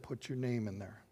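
soft, subtle prompting; little inner voice. Retold or imitated. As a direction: speak it quietly, like a voice within. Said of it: "and the voice said".

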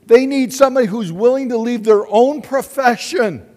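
high, loud revival-preacher voice rallying the church; fiery preaching. Acted. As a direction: preach it at full force to stir the congregation. Preaching at full force.

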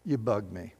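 low, quiet brush-off muttered by the governor; dismissive governor. Retold or imitated. Roman governor brushing off the plea, low and dismissive. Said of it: "Galileo goes".

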